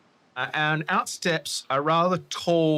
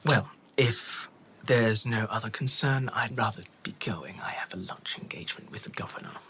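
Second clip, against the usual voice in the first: softer and softer unvoiced whisper; whispered aristocrat; 4 semitones lower, 4 dB quieter than usual. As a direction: dismiss them in a fading hush. Corbulo speaks, whispered voice.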